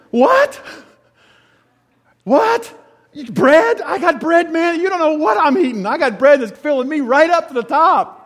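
high, loud, astonished retort; incredulous Jesus. Acted. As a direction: burst out amazed that they don't get it. Jesus answering the disciples.

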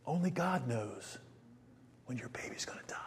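zacht, bijna fluisterend, troostend; hushed comfort. Retold or imitated. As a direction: fluister het troostend tegen een huilende moeder. Comforting a crying mother.

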